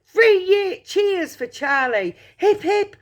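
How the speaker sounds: high-pitched rallying cheer; celebratory shout. A narrator's reading. Grandpa Joe leading cheers for Charlie, celebratory shout.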